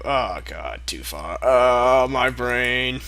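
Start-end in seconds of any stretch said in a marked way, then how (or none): none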